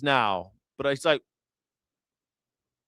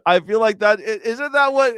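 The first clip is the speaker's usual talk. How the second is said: usual speaking voice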